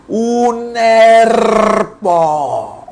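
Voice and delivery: put-on high, very drawn-out child's yell with a proudly rolled R; shrill seven-year-old's cry. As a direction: squeal it like a kid showing off his new trill. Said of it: "pegué el grito con voz chillona de siete años". (grito chillón de niño de siete años, con la erre vibrada)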